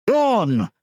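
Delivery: high, loud roar; roaring crowd. Acted. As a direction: roar back as one. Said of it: "they roared".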